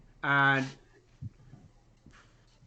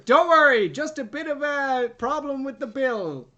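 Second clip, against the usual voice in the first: higher, much louder cheery reassurance; put-on waiter voice; 9 semitones higher, 7 dB louder than usual. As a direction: sing it out high and loud like a jolly host. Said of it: "Wait, he goes".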